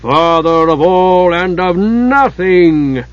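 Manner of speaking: "nothing" slow, heavy and ominous; stress on nothing. A narrator's reading. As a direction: lean darkly on "nothing". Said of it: "with an ominous emphasis on the latter".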